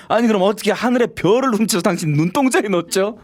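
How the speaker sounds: can't keep a straight face; laughing pickup line. Acted. Man cracking up while delivering a corny line.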